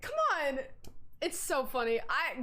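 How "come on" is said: baffled. Retold or imitated. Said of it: "I'm like".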